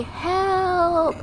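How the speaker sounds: high, drawn-out call; mock plea for rescue, not shouted; acted cry for help. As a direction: stretch it out high like calling from a ledge. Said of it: "you have to at least say".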